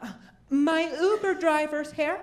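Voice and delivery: high call to the next room. Acted. Husband leaving for a flight calls to his wife, calling out. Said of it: "I called to my wife in the next room".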